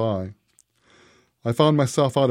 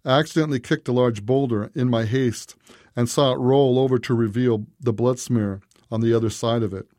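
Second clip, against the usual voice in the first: ordinary delivery, nothing marked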